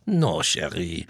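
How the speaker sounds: slow French accent put-on; french chef. Mock French reply.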